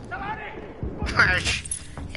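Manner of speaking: high shrill parrot squawk; cockatoo scream. Retold or imitated. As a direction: screech like a cockatoo. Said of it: "That's my cockatoo screaming impression".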